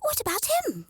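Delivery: high, insistent chick voices; chicks demanding. A narrator's reading. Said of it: "they demanded".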